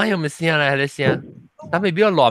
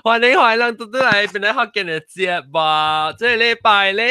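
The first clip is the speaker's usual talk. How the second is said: host intro; 6 semitones higher than usual. Real, not acted. Host welcomes listeners.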